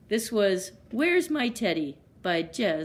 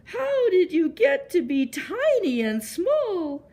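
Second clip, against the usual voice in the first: high, louder bawling cry; 10 semitones higher, 5 dB louder than usual. A narrator's reading. Giant bear sobbing at the tiny teddy, high and bawling. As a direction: wail it out across the whole woods. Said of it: "the voice bawl".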